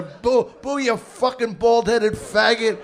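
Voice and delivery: loud jeering heckle, slow at the end; crowd booing. Retold or imitated. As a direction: yell it like an angry crowd. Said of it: "they're fucking screaming at him".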